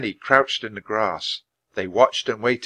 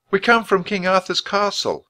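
speaking loudly; 10 semitones higher, 4 dB louder than usual. A narrator's reading. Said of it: "She spoke loudly to be heard over the music".

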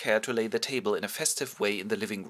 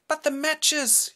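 very slow, high wife voice; alarmed wife; 4 dB louder, much slower than usual. Acted. Mrs Biedermann realising the matches, slow and high. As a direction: draw out the horror of realising.

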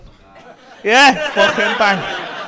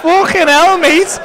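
high, comic disbelief; hammed-up exasperated scolding; mock exasperation; 6 semitones higher than usual. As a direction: pretend to be appalled by his standards. Mock exasperation at pub food.

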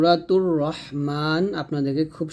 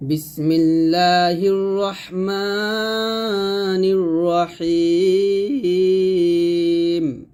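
slow, sustained chant with long held vowels; measured tajweed chanting; Quran recitation; 2 semitones higher, 3 dB louder, much slower than usual. Shown as a demonstration. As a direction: recite it slowly, drawing out each long vowel.

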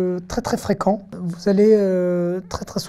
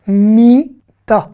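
"Mita" slowly as a long mi, then ta; louder, separated syllables in "Mita"; 5 dB louder than usual. Shown as a demonstration. Teacher decoding the Hebrew word for bed, syllable by syllable. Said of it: "on va faire consonne plus voyelle".

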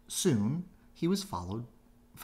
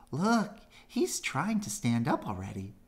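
shouting little rabbit; 6 semitones higher, 4 dB louder than usual. A narrator's reading. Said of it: "shouted one of the little rabbits".

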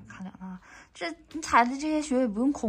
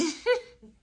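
laughing; 8 semitones higher than usual. Real, not acted. Laughs at mother's cluelessness.

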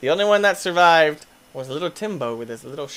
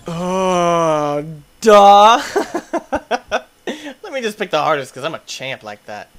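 ordinary talk, nothing marked

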